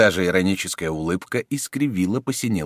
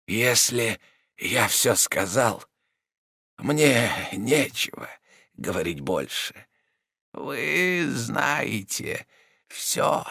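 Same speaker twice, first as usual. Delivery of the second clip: weak dying man; 4 semitones higher, much slower than usual. A narrator's reading. Rodin voice.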